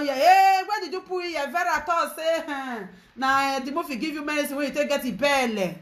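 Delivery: high, loud mock gossip voice; mock-scandalized, starting loud and high; mimicked gossips. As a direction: imitate busybodies spreading a rumour. Mimics gossips spreading her words.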